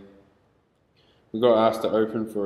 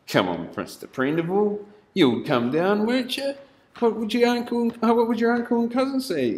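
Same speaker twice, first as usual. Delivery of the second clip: high, sing-song wheedling cop; mocking the coaxing cop; 12 semitones higher, 3 dB louder than usual. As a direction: coax him like a fake-friendly cop.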